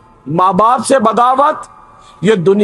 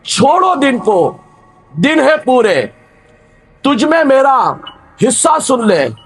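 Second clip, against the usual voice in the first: poem recitation. Acted. Recites a nazm in sermon.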